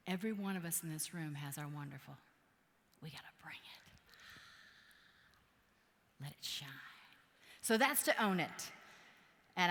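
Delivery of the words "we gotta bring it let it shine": hushed, breathy push to bring it; whisper. Real, not acted. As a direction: whisper it like a secret. Whispered line in motivational talk.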